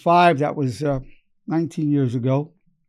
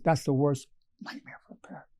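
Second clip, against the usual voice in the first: hushed; 2 semitones lower, 7 dB quieter than usual. Retold or imitated. Consoling a mother whose son was shot.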